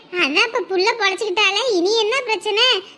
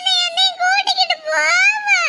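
put-on wailing child; 10 semitones higher than usual. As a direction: cry out that dad's food is inedible. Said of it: "சோத்துக்கு தான் இந்த ஒரு ஒப்பாரியா?".

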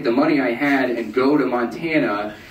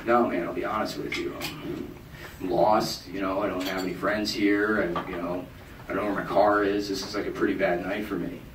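quiet, low confession; breaking down; 4 semitones lower, 7 dB quieter than usual. Retold or imitated. Lost drunk confessing to a stranger, quiet and low. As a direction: let it all spill out softly. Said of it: "sort of broke down".

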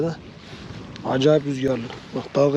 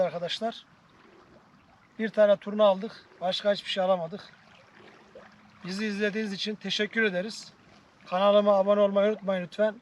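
higher, softer sign-off voice; vlog outro; 6 semitones higher, 5 dB quieter than usual. Acted. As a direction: wrap up gently to viewers.

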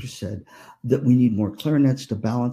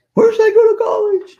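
put-on very high, louder girlish question; teen girl voice; 7 dB louder than usual. Niece asking aunt about college, high girlish voice. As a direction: ask it like an eager teen girl.

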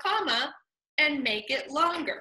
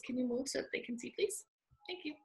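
quiet aside; 2 semitones lower, 14 dB quieter, much faster than usual. Real, not acted. Teacher softly asking a student to move.

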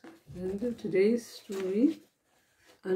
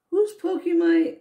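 higher, louder indignant muffled cry; 8 semitones higher, 5 dB louder than usual. A narrator's reading. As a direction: protest from inside the trunk. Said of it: "a muffled voice".

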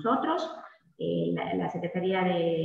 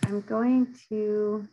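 ordinary talk

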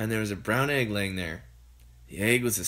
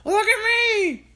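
put-on high, loud old woman's shout; Grandma shouting; 6 dB louder than usual. A narrator's reading. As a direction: call down from the roof as Grandma. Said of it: "Grandma shouted from the rooftop".

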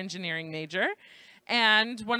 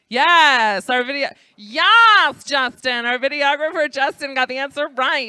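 loud, jubilant announcement; excited cheering; 4 semitones higher, 9 dB louder than usual. Real, not acted. Host celebrating the videographer's right answer, loud excited cheer. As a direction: cheer loudly for the winner.

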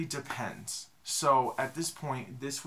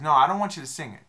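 emphatic; 3 semitones higher, 11 dB louder, faster than usual. Retold or imitated. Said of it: "He said".

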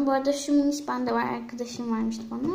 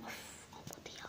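whispered aside; 25 dB quieter than usual. Real, not acted. Muttered aside after failed intro.

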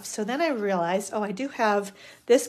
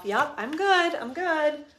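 higher-pitched mock self-reassurance; convincing herself; 6 semitones higher, slower than usual. Host pep-talking herself before flipping the camera, mock self-convincing. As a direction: reassure yourself in a raised, singsong pitch. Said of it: "I'm trying to convince myself".

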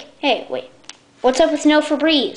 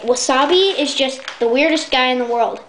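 normal talking voice

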